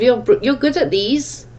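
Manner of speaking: ordinary talk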